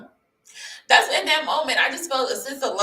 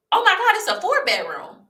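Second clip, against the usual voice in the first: much higher, gushing exclamation; excited realtor; 11 semitones higher, 4 dB louder than usual. Retold or imitated. Realtor discovering the back rooms, high and excited. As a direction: imitate a thrilled discovery, pitched high.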